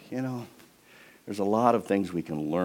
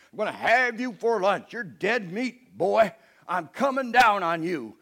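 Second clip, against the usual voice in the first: booming giant; 11 semitones higher, 7 dB louder than usual. Acted. Giant taunting little David, loud mocking roar. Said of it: "That's the original Hebrew".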